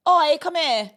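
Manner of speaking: put-on Huddersfield accent. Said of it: "So Huddersfield will be a".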